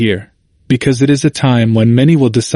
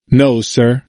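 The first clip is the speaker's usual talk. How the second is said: ordinary talk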